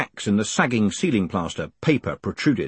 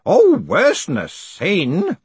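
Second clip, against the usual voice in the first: high, loud, drawn-out near-sneer; almost sneering; 9 semitones higher, 6 dB louder, slower than usual. A narrator's reading. Grote corrects Moist about sin, almost sneering. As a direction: stretch it out with scorn. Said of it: "almost sneering".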